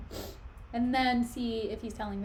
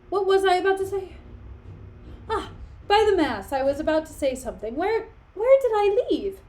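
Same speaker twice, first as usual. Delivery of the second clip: put-on loud, higher, befuddled old man; flustered old Polonius; 7 semitones higher, 6 dB louder than usual. Old lord to servant, loudly forgetting what he meant to say. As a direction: bluster about, having lost your place. Polonius loses his thread.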